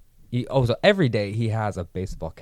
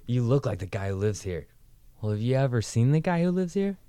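movie dialogue; 6 semitones higher, 6 dB louder than usual. Retold or imitated. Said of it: "my favorite part was".

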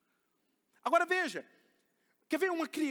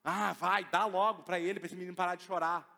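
louder, mock weary parent caving in; giving-in parent; 8 dB louder than usual. Father caving in so the child stops crying, louder. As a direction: imitate a tired dad who just gives up. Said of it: "o que que a gente faz como pai?".

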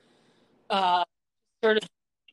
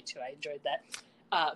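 ordinary talk, nothing marked

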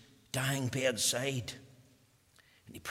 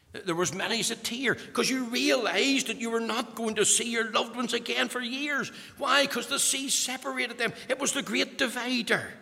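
raised, ringing pulpit voice; preaching at full force; 10 semitones higher, 4 dB louder than usual. Acted. Preacher proclaiming heaven to congregation, full force.

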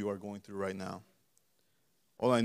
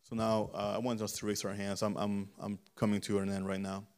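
usual speaking voice

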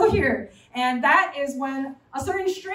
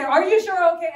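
higher, louder worried mother; nagging mom; 5 semitones higher, 6 dB louder than usual. Retold or imitated. Mother nagging her grown child, louder and higher. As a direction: press the question anxiously.

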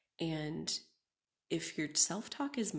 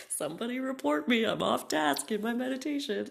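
mock alarm; 6 semitones higher, 8 dB louder than usual. Mock outrage at herself.

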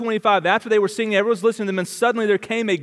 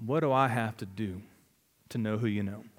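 soft, low, humble asking; humble plea; 8 semitones lower, 9 dB quieter than usual. Acted. Jailer on his knees asking Paul and Silas, soft and humble. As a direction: kneel and ask it quietly. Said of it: "in great humility".

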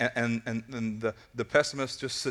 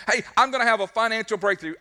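high, eager announcement; excited; 7 semitones higher, 3 dB louder, faster than usual. Acted. Believer sharing hopes with a naysayer, excited. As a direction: proclaim the hope with enthusiasm. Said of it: "what are you so excited about".

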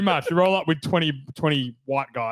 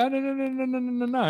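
mock high protest; 10 semitones higher than usual. Mock refusal to freeloading mates.

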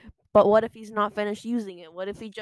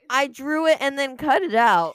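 laughing; 5 semitones higher, 7 dB louder than usual. Real, not acted. Defending his drawing, laughing.

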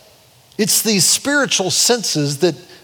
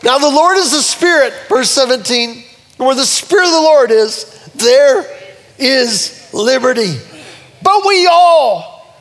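full-force preaching; 7 semitones higher, 5 dB louder than usual. Real, not acted. Preacher reads verse seventeen on liberty, full-force preaching. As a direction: ring out the verse to the congregation.

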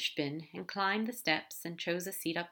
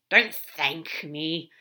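slow, loud, lowered growl; 2 semitones lower, 7 dB louder, much slower than usual. Acted. Crowley refusing Aziraphale's thanks, growling. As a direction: growl it out slowly. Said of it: "Crowley growled".

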